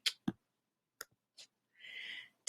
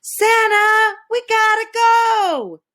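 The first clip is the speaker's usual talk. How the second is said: loud, much higher shout up the chimney; yell down the chimney; 14 semitones higher, 5 dB louder than usual. Acted. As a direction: call out urgently, high and loud. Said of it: "when I yell".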